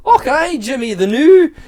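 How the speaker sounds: S1 imitates a colleague's comedy Scottish accent; mock comedy Scottish accent. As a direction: parody a cheesy stage Scottish accent. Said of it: "kind of accent".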